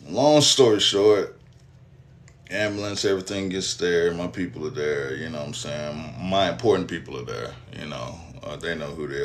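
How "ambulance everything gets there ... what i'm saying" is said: ordinary talk, nothing marked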